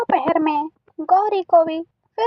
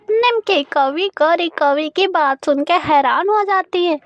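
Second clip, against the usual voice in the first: plain reading voice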